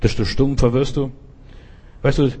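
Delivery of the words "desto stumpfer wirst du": normal talking voice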